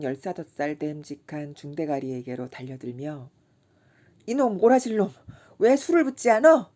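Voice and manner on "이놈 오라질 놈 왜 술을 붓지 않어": put-on high, loud drunken scolding; scolding shout. A narrator's reading. Kim yelling at the serving boy for not pouring, angry. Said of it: "야단을 쳤다".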